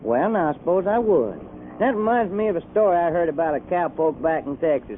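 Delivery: folksy Texan storytelling; Texas accent. Acted. Blake starts a story from back in Texas, Texas accent. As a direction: spin a cowpoke yarn in a Texas accent. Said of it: "do you ever get tired of that accent?".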